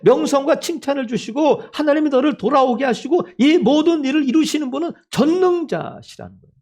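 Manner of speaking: high, driving sermon delivery; impassioned preaching. Real, not acted. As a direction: proclaim the Almighty with rising fervor. Preaches at full force. (높은 음으로 열정적인 설교)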